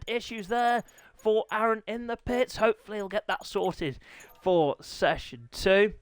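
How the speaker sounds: ordinary talk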